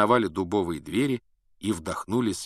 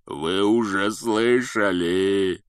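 slow, loud, growling giant; giant's growl; 5 dB louder, much slower than usual. A narrator's reading. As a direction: growl it out, sobbing. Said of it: "прорычал Хагрид".

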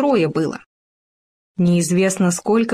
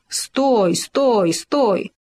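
wolf calling out in the chase, lightly raised; a hint of a hungry wolf's holler; mild mock shout; 3 semitones higher, much slower than usual. A narrator's reading. Wolf chasing the runaways, mild mock shout. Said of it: "и волк закричал".

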